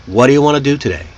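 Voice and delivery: What do you want to do today very fast and linked; natural reduction. Shown as a demonstration. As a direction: blur it into "whaddya wanna do today". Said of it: "Reduce it naturally".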